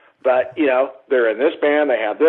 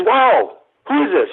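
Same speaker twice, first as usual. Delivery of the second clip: high, amazed question; gushing over a stranger's photos; amazed wow; 8 semitones higher, slower than usual. Retold or imitated. David marvelling at John's concert photos, amazed.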